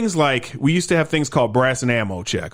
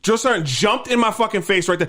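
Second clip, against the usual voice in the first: usual speaking voice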